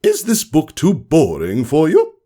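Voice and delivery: high, mocking question; sarcastic teacher. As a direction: needle the sleepy pupil. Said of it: "Her words dripped with sarcasm".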